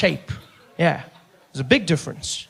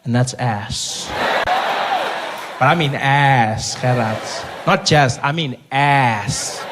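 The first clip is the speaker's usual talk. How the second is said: slow, low, drawn-out relish; low slow appreciative drawl; lustful emphasis; 5 semitones lower, slower than usual. Acted. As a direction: savour every word. Savoring word, slow and low.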